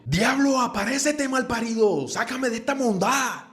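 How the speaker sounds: hammed-up child summoning the devil; mock tantrum scream. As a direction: screech at the devil like a spoiled brat. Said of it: "el peladito seguía".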